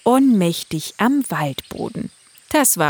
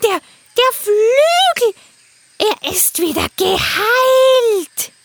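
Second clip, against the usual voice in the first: high, stammering, slow awed bird voice; a parrot that can't believe it; amazed parrot; 5 dB louder, slower than usual. Acted.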